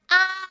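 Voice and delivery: high, held "aah"; open-mouthed "ah". Acted. Totoi opening up for a gumball, high "aah". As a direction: open wide and sing out "ah".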